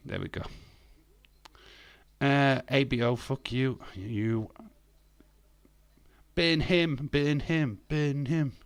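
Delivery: ordinary delivery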